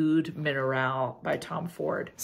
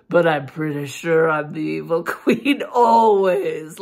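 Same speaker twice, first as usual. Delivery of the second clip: louder, lightly villainous; a hint of a wicked queen; evil queen voice; 9 dB louder than usual. Shown as a demonstration. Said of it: "listen to this voice".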